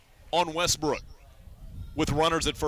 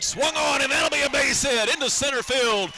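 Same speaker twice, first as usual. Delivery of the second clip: rising, louder play-by-play shout; radio announcer calling a hit; excited hit call; 8 semitones higher, 3 dB louder than usual. Real, not acted. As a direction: call the base hit with a lift.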